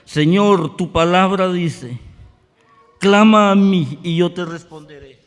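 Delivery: loud retold prayer, pleading; crying out to God. Preacher retelling his desperate prayer for Glenda, loud pleading. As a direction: call out to the Lord from the floor. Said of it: "Pero yo empecé a gritar".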